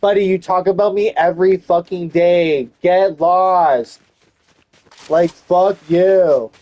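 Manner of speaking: loud, high angry telling-off; answering the family, loud and high; angry retort. Retold or imitated. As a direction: tell them off furiously. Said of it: "I'm like".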